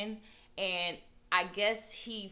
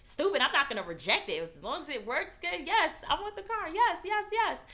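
high, loud and eager; eager excitement; 12 semitones higher, 5 dB louder than usual. Retold or imitated. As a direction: gush like you can't wait to say yes. Eager acceptance of car offer.

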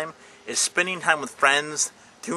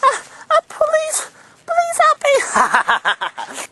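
much higher squeaky pleading; squeaky toy begging for mercy; pleading monkey; 5 dB louder, much slower than usual. Acted. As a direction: beg for help in a tiny voice, giggling.

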